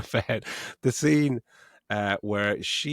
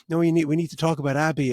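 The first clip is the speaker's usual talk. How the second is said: urgent; 6 semitones higher, 4 dB louder, faster than usual. Retold or imitated. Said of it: "she's like".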